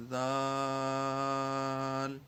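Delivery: ذال held long for five beats, no whistle on the ذ; drawn-out letter. Shown as a demonstration. Teacher showing students a long ذال without whistling. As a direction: stretch ذال for five counts, keep the hiss out. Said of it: "پانچ حرکات کے برابر کھینچ کر پڑھنا ہے".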